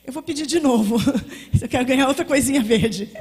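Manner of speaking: laughing request to God for another green gift. Retold or imitated. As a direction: giggle while asking again.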